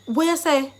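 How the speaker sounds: high, loud Jamaican patois; excited Jamaican patois. Shown as a demonstration. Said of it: "So if you're excited".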